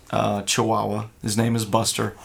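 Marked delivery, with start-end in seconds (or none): none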